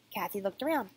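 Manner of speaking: usual speaking voice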